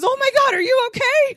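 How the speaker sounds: mock panicked lover, shouting high. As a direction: yell it like he's terrified he hurt her. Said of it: "and he goes".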